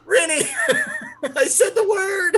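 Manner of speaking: high, laughing shouts of glee; excited laughing. Real, not acted. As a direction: squeal it out delightedly. Player excited about codeword.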